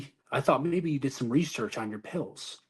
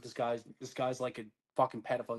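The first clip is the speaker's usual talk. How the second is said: usual speaking voice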